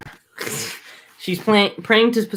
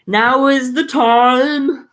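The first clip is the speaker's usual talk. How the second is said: put-on high, slow, airy old man; quavering old king, slowly; 8 semitones higher, 5 dB louder, much slower than usual. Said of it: "I'll give Nestor, like, an old man voice".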